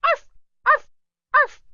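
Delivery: high yappy barks; dog barking. A narrator's reading. Little dog warning off the pirate, high yaps. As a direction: bark like a small dog, high and loud. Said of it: "she said".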